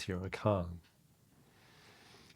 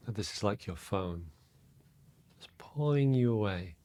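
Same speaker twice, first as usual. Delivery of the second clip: guided meditation; 6 dB quieter than usual. Real, not acted. Meditation teacher to listeners settling into their breath, soft and calm. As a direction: guide them inward quietly, leaving space between phrases.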